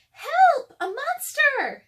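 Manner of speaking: put-on high, loud little-girl cry for help; Annie shouting. A narrator's reading. Little sister playing monster game, high loud shout. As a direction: shriek it like an excited girl playing pretend. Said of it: "said Annie".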